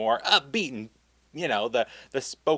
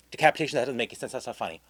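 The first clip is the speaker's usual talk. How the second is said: imitating a prim supervisor striking jokes; mock prim objection; 2 semitones lower, faster than usual. Script supervisor dismissing the joke, prim and fast.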